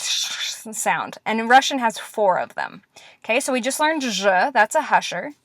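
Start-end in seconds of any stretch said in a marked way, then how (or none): 0.0-1.2 sh, sh drawn out softly as a hush; hushing sound demo. Shown as a demonstration. Teacher demonstrating hushers, drawn-out soft sh. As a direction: stretch the sh sound quietly. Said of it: "make the sh".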